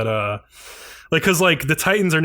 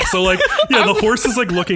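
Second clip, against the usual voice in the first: laughing; 6 semitones higher than usual. Real, not acted. Still laughing at the scene.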